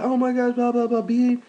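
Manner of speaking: mock TV argument. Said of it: "and, like".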